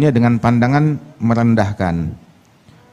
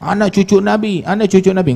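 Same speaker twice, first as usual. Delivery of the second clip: high, insistent mocking chant; mock boastful cry; 7 semitones higher than usual. Preacher mimics a boast of descent from the Prophet, mock boastful cry. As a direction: imitate a braggart repeating his pedigree. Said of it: "nggak jerit-jerit nasab".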